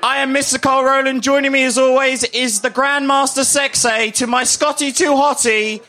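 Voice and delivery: big, slow show host opener; announcer voice. Acted. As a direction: announce it to the live crowd.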